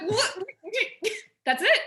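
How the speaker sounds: high-pitched laughing disbelief, playing up the retold moment; amazed disbelief. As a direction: squeal it through a laugh, astonished it was so easy. Retelling disbelief at the easy answer.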